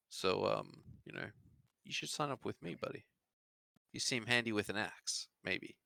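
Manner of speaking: lightly voiced recruiter; recruiter character.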